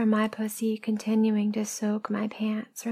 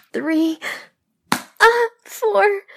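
high, loud yelps; crying out the count; 11 semitones higher, 9 dB louder, much slower than usual. Acted. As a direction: yelp each number as if struck. Counting aloud during acted paddling.

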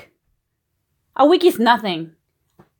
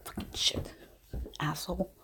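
whispered curse; 9 semitones lower, 18 dB quieter than usual. Acted. Furious girlfriend cursing under breath.

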